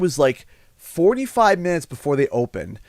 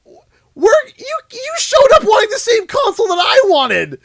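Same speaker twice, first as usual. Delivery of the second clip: indignant retort; 8 dB louder than usual. Retold or imitated. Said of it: "And I'm just like".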